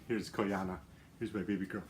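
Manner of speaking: low soft pet talk; cooing to a pet. Real, not acted. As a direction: coo lovingly to the dog. Cooing over her dog.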